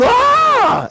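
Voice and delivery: high startled yelp; mock the fright at the window; startled scream. Preacher acting his scream at the thief, high yelp. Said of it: "I, I, like, scream".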